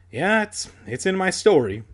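mildly sheepish admission; confessing. A narrator's reading. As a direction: stumble into the confession. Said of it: "I confessed".

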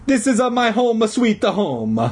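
put-on high, indignant Italian accent; huffy old Italian janitor; angry Italian voice. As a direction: protest in cartoon Italian. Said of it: "the man said angrily".

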